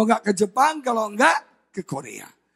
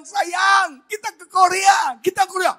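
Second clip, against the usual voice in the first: high, raised shout of good news; excited calling out; 9 semitones higher, 4 dB louder than usual. Retold or imitated. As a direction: imitate an overjoyed husband calling his wife. Husband breaks the good news. (berseru kegirangan, nada tinggi)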